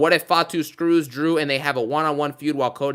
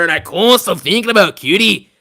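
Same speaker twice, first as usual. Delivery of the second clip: Joe Tessitore call; 3 semitones higher, 8 dB louder than usual. Retold or imitated. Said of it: "as Joe Tessitore would say".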